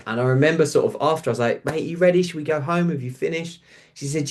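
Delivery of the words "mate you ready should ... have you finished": gentle caring check-in. Retold or imitated. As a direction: ask kindly, not to rush her. Gently checking on grieving client.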